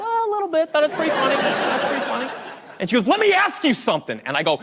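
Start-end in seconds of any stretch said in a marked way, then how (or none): 0.0-2.3 high, small-voiced sheepish confession; cheeky admission. Cheekily admits the joke to Marie. 3.1-4.2 high-pitched, indignant confrontation; mock pissed-off. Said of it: "Marie got pissed. She was not happy".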